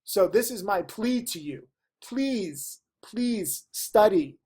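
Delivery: ordinary talk, nothing marked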